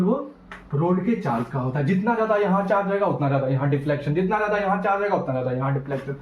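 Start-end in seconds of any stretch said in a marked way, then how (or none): 1.9-6.2 fast sing-song recitation, rising then dropping; quick, rhythmic chant-like repetition; rapid drilled rule. Real, not acted. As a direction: rattle it off like a memorised rule. Teacher drills the rule twice.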